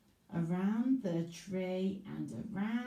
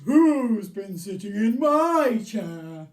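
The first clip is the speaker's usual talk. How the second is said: big booming bear voice, much louder, slow; loud slow Daddy Bear; 13 dB louder, slower than usual. Acted. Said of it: "Daddy Bear says".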